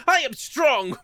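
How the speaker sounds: very high put-on defiant cry; hammed-up retort in a squeaky high pitch; Noah. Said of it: "But Noah just going".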